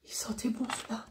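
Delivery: soft voiceless whisper. Real, not acted. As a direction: murmur it fondly, almost silent. Whispered memory of perfume. (chuchoté)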